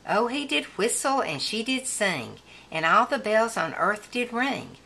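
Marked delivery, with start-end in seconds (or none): none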